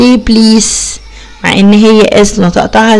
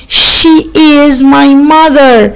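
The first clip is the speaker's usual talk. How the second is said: very slow, each word clear; slow model reading; 3 semitones higher, much slower than usual. Shown as a demonstration. Teacher modelling the sentence slowly for pupils. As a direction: say it slowly for them. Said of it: "يبقى".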